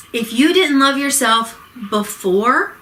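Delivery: usual speaking voice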